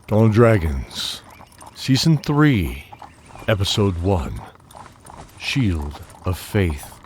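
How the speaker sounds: slow, loud episode announcer; title announcement. Acted. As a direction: announce each title part grandly, drawn out.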